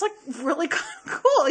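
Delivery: ordinary talk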